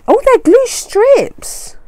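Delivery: high and drawn-out thrill; slow, high oh of delight; delighted discovery. Real, not acted. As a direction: gush over a new find. Discovering glue strips in parcel.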